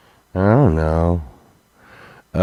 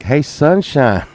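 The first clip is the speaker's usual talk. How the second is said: sly offer; 8 semitones higher, 6 dB louder than usual. Retold or imitated. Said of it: "And then I'm like".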